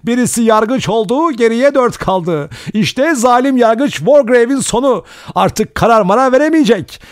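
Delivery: high, loud, manic ranting; hysterical outburst. A narrator's reading. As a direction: rave in hysterical glee. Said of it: "birden gülmeye başladı". (histerik, çılgınca bir boşalma)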